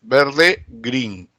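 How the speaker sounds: "green" said grin, with an ee like Spanish i; the kids' song "green" as grin; Spanish vowel "green". Shown as a demonstration. Teacher showing how kids sang "green" as grin. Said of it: "cómo pronunciaban el verde".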